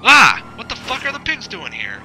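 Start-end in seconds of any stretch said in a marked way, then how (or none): 0.0-0.4 sudden high yelp of alarm; a sharp high cry as he spots them; startled yelp. Real, not acted. The driver spotting police, a loud yelp.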